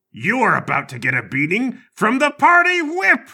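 high, loud villain boast; hammy old politician threatening; gleeful threat. Acted. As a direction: crow it like a pantomime villain. Old congressman brandishes whip.

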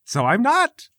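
high, nervous lady of the house; flustered Patricia. Acted. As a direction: squeak out the excuse, flustered.

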